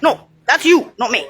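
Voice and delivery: ordinary delivery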